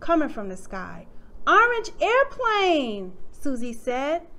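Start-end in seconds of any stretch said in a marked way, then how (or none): 1.5-3.1 loud, high, drawn-out delight; excited exclamation. A narrator's reading. Susie spots a toy in the backyard, excited. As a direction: stretch it out in happy discovery. Child's delighted find in story.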